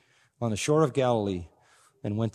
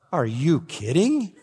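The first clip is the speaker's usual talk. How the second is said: usual speaking voice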